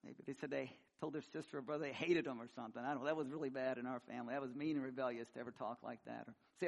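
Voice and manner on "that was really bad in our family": ordinary talk, nothing marked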